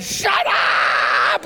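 wife's scream. Retold or imitated. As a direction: scream it like a wife in labor. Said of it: "And she's screaming".

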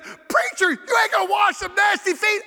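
high-pitched mock outcry; jeering team shout. Team protesting the preacher washing nasty feet, mock high shout. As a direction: imitate a rowdy team hollering in disbelief.

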